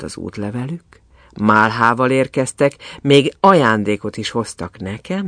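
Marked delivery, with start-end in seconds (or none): none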